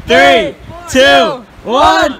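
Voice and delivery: shouting countdown. Real, not acted. Countdown before ice plunge.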